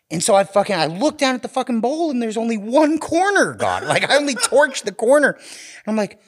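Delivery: high, loud, rushing punchline energy; laughing, amped-up storytelling; excited build. Real, not acted. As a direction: blast it out with mounting hype. Climax of the story, hyped.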